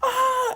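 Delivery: a short, very high shriek of surprise; shocked shriek. Retold or imitated. Girlfriend seeing the ring, high shriek. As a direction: shriek like she just saw the ring. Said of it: "she goes, gasps".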